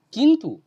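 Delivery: কিন্তু punched loud and high, then falling; stress on কিন্তু. Real, not acted. Teacher flags the key কিন্তু in the quoted poem line, stressed. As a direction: hit কিন্তু hard as a warning turn. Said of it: "এখানে একটা কিন্তু আছে".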